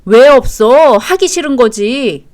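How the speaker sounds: loud retort, then drawn-out jab; angry accusation. Acted. Husband accusing wife of not wanting to, angry. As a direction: cut in loudly and accuse.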